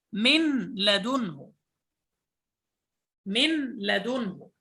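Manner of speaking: من لدنه as "milladunhu", slow with a doubled l; slowed idgham. Shown as a demonstration. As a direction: stretch من لدنه, nun swallowed into the l. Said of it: "فأدخلنا النون في اللام".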